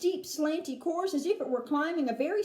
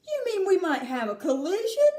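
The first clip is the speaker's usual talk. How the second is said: alarmed cry; 5 semitones higher, 5 dB louder than usual. Acted. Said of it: "cried Mister T V".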